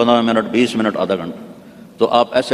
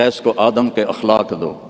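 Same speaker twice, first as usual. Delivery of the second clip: strange heavenly voice. Retold or imitated. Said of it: "ایک عجب آواز آئی".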